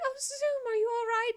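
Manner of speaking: very high, cooing worry; acted high-pitched pity; sympathetic concern. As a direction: fuss over a friend who was hurt. Jin pities charmed Zoom.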